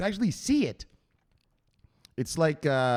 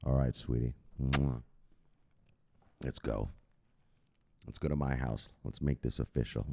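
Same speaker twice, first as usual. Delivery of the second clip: much lower and softer smooth talk; low seductive voice; 13 semitones lower, 8 dB quieter than usual. Acted. Inviting Teresa home, low soft seductive voice. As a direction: purr it like a lover.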